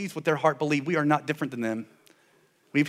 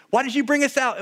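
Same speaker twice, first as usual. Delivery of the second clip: mock complaint; 9 semitones higher, 4 dB louder than usual. People's complaint thrown at Moses.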